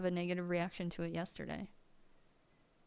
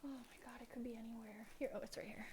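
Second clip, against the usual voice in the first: breathy whisper, much softer; 15 dB quieter, much faster than usual. Real, not acted. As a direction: whisper so you don't startle anyone. Hushed searching for remote.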